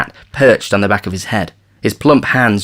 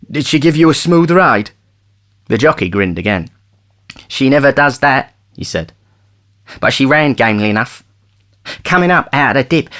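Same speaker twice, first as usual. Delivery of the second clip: jockey voice; 5 semitones higher, 3 dB louder than usual. Acted. Jockey's excited report.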